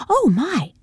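put-on high, girlish gasp of surprise; a young lady caught off guard; startled young girl. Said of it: "the girlish pitch to her voice".

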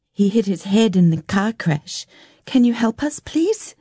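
high voice climbing into a small please; explain, then beg as a worried girl; pleading child. Acted. Girl asking a woman to help injured brother, pleading.

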